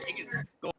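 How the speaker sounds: hushed whisper of a rumor. Real, not acted. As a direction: drop to a secretive whisper. Sharing a rumor on air.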